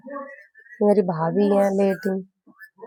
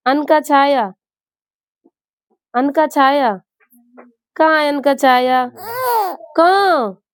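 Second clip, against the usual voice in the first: high, loud coaxing calls to a newborn; 5 semitones higher, 4 dB louder, slower than usual. Real, not acted. Aunt calling to newborn nephew.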